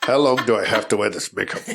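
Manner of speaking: quick mock-actor gabble; imitating a hurried, fed-up actor; rushed delivery. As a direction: rush the line out to be done with it. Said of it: "just trying to rush to get them out".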